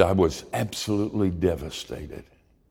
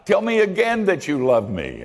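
high, loud giddy plea, dancing with joy; much higher, loud, like a giddy child; giddy Gideon; 13 semitones higher, 4 dB louder than usual. A narrator's reading. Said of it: "Gideon danced some more".

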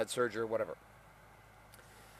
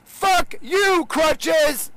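a much higher, loud scream; screaming at crutches; 9 dB louder, much slower than usual. Retold or imitated. Fed-up patient screaming at his crutches, loud and high. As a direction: yell it at the crutches with everything. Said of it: "wanna scream at my crutches".

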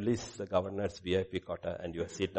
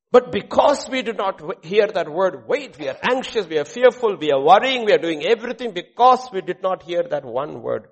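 loud, high, driving sermon delivery; full-force preaching; 8 semitones higher, 11 dB louder than usual. Acted. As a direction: preach it urgently, pounding each clause.

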